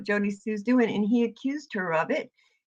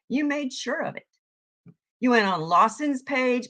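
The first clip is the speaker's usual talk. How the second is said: ordinary talk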